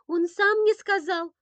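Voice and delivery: put-on high, loud childlike begging; pleading little boy. A narrator's reading. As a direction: beg mum excitedly in a small boy's high voice. Boy insists kitten talks.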